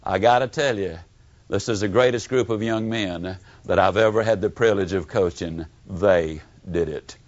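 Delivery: a low, proud coach's voice, dropping to the end. Retold or imitated. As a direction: lightly voice the gruff coach bragging on his team. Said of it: "he would always say".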